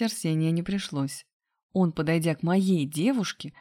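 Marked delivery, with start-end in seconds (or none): none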